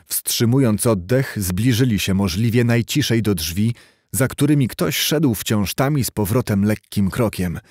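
ordinary delivery, nothing marked

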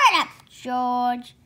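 loud excited shout. A narrator's reading. Father shouting to George over the pot. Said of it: "shouted Mister Cranky".

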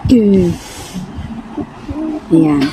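usual speaking voice

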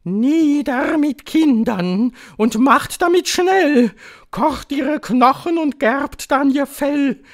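high, loud witch's chant; witch's spell in piercing singsong; shrill incantation singsong. A narrator's reading. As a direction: intone the rhyme shrilly and rhythmically. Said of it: "mit schriller Stimme irgendeinen Beschwörungssingsang".

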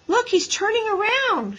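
very high, excited shout; girl's high-pitched alert. A narrator's reading. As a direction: cry out the news excitedly. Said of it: "shouted Mary Jane".

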